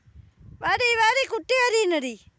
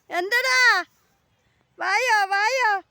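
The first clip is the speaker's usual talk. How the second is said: coaxing call; 4 semitones higher, 4 dB louder, slower than usual. Real, not acted. Calling pets to come.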